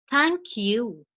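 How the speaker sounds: "Thank you" starting high and falling low; falling tune. Shown as a demonstration. Teacher demos a falling "Thank you" to students. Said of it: "the voice starts high and ends low".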